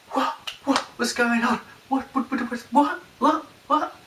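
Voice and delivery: high-pitched, baffled repeated questions; confused client. Retold or imitated. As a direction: imitate someone utterly bewildered, stuttering the same word. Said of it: "He was confused".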